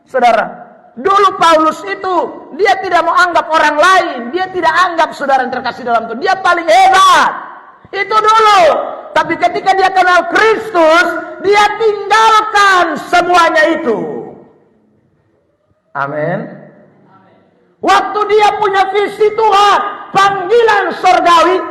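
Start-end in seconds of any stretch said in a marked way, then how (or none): 1.0-5.2 raised, high pulpit voice; forceful preaching. Acted. Preacher telling of Paul's former pride, forceful preaching. 6.2-14.0 high, ringing pulpit climax; forceful preaching. Acted. Preacher proclaiming Paul's change to congregation, forceful preaching. 17.8-21.6 high, raised proclamation; forceful preaching. Acted. Preacher proclaiming Paul's heavenly calling, forceful preaching.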